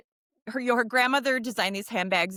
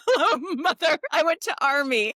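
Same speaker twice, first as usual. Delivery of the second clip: put-on higher, louder awkward man-child voice; Buster Bluth voice; 5 semitones higher, 4 dB louder than usual. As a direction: sound like an awkward sheltered son. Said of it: "now I sound like Buster Bluth".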